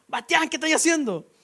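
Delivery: a hammed-up angry father's shout; mock furious dad. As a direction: yell at the son like a furious dad. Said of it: "Mi papá pasa furioso".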